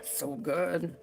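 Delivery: just sipped coffee, soft murmur; mid-sip, savoring coffee; sipping coffee. Real, not acted. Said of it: "Sorry, I'm sipping my coffee".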